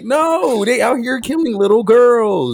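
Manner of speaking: high, worked-up mock outrage; comically appalled and high-pitched; incredulous protest. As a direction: protest as if nobody is getting it. Acted protest at killing girls.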